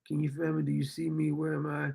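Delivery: soft and low, almost ghostly; hushed reading. Acted. Reading her lines to viewers as a faint, fading voice. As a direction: breathe the words like a fading ghost.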